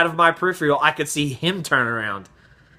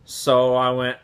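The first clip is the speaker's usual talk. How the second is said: ordinary talk, nothing marked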